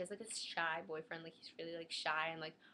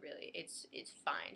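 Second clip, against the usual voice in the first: deadpan sarcasm; 6 semitones lower, 3 dB quieter than usual. Retold or imitated. Teen mocking the nurse's reassurance, low deadpan. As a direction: drag it out, unimpressed.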